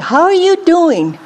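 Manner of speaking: high, lilting retold hello; friendly greeting. Preacher greeting a mother with a baby, friendly. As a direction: coo it as a caring greeting.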